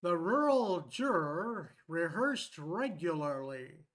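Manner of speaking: "The rural juror rehearsed regularly" loud and over-articulated, each R leaned on; careful tongue twister. Shown as a demonstration. Teacher testing the app, "rural juror rehearsed regularly" in exaggerated tongue-twister diction. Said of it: "let's add a tongue twister".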